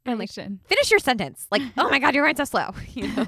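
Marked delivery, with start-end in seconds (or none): 0.7-2.7 higher, louder exasperated quote; impatient. Retold or imitated. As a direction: huff it out impatiently. Mock impatient inner voice.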